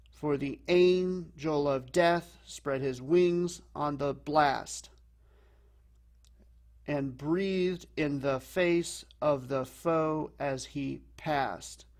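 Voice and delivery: slow scansion, two light syllables then a heavy "angel", "death", "wings", "blast"; da-da-DUM beat, landing hard on "face", "foe" and "passed"; anapestic stress. Shown as a demonstration. Teacher showing unstressed, unstressed, stressed, slow anapestic beat driving to "blast" and "passed". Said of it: "it's gonna be unstressed, unstressed, stressed".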